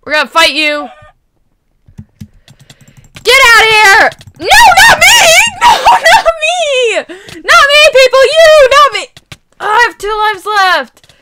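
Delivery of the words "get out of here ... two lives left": high, loud pleading shouts; laughing, frantic yells; panicked shouting. Real, not acted. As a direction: scream not to be pushed off. Pushed off the platform.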